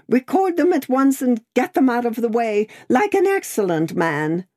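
high, urgent pleading; distraught crying. A narrator's reading. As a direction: hurry him along, mildly tearful. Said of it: "still wildly crying".

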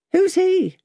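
very high, sharp question; Miss Pross. A narrator's reading. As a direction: demand it in a high character voice.

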